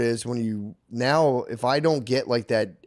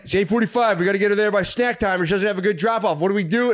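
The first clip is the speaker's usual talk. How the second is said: frantic rush; 6 semitones higher, faster than usual. Retold or imitated. Frantic caffeinated self retold.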